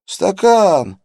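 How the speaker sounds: high, loud gurgling cry, falling; gurgling indignant yell. A narrator's reading. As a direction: bubble out an outraged yell. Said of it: "возмущённо завопил малец натруженным булькающим голосом".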